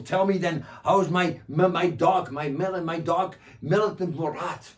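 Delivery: loud, stumbling over words; eager asking. Acted. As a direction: ask eagerly, tripping on my. Bedou asks about dog.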